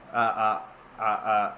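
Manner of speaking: ordinary talk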